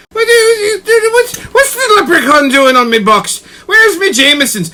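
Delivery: mock Irish. Mock Irish person complaining.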